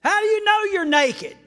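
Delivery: high loud incredulous ask; mock question. Preacher mocking God's question, high and loud. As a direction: ask it like it's obvious. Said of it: "What a dumb question".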